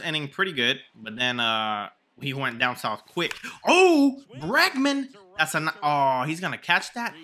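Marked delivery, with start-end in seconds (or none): 3.7-5.1 sudden high, loud cry of the name; excited shout. Real, not acted. Gamer reacting to his hitter's drive, excited shout. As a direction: burst out at the hit.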